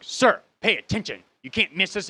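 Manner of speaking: loud, high-pitched mock scolding; imitated yelling. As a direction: snap at an inattentive passenger, imitating the agent. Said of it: "she's yelling at me".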